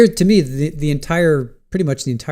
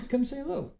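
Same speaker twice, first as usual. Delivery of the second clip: calling the cat; 12 semitones higher, 11 dB quieter than usual. Real, not acted.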